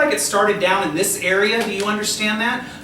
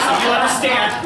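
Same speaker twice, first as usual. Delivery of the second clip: laughing; 5 semitones higher, 4 dB louder than usual. Real, not acted. Medium laughing at spirit's joke.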